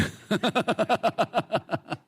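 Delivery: high sheepish laugh; high giggle. Retold or imitated. Boy confessing to the girl, high sheepish giggle.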